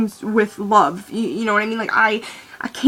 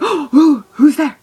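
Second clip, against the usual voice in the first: higher and louder, hammed-up gasp of surprise; mock surprise; 5 semitones higher, 8 dB louder, slower than usual. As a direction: play along with a big fake "oh!". Playing along with knock-knock joke.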